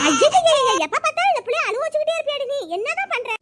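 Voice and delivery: high, quick nagging outburst; exasperated scolding. Acted. Mother-in-law to daughter-in-law over the crying baby, exasperated scolding. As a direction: start loud and high, scold in a rush.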